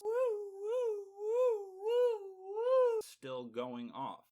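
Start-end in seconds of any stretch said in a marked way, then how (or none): 0.0-3.0 repeated high, loud siren whoops; piercing mock alarm woos; car alarm imitation. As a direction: wail like a car alarm going off. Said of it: "had that alarms".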